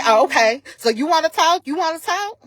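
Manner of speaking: mocking challenge.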